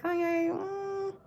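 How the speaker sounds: high, slow, knowing disapproval; disapproving drawl. Retold or imitated. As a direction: stretch it out, shaking your head. Drawn-out disapproving address.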